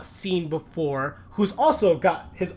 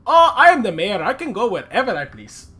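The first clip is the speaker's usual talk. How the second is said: pompous mayor; 4 semitones higher, 7 dB louder than usual. Acted. Mayor brushing off complaint.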